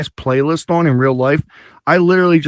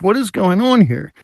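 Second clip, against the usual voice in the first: confused; 6 semitones higher than usual. Retold or imitated. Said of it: "I'm getting confused".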